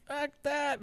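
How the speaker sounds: high mock disgust; scoffing refusal. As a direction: toss it off in disgust. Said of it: "I'm like".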